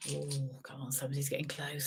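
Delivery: unvoiced teasing whisper. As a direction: whisper it like a secret. Teasing hush before number.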